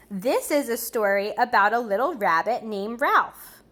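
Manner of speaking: put-on lively read-aloud for children; storybook reading. A narrator's reading. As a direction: read it like a bedtime picture book. Reading a picture book aloud.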